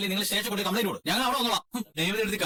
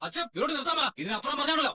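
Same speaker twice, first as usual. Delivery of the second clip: high, sharp angry order to stop; angry demand; 6 semitones higher than usual. Acted. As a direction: shout them down, furious. Son angrily silencing his aunt. (ദേഷ്യത്തോടെ ഉറക്കെ)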